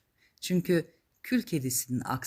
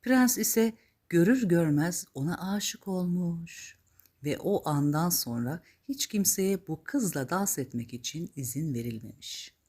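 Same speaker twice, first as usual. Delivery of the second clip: plain reading voice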